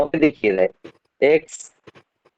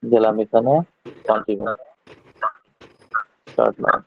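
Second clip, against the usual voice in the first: doubled echo on the line; 4 semitones lower than usual. Real, not acted. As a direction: voice echoing through the call. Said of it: "আপনার কথাগুলি ইকো হচ্ছে". (প্রতিধ্বনি হওয়া কণ্ঠ)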